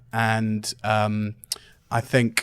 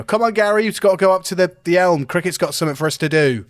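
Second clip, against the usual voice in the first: louder, higher shout across the room to Gary; calling out across room; 6 semitones higher, 4 dB louder, faster than usual. Acted. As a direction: call him over from across the room. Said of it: "you hear me shout across".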